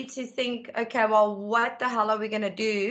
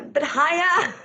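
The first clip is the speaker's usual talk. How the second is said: laughing hint; 9 semitones higher, 3 dB louder, slower than usual. Retold or imitated. Daughter hinting, laughing.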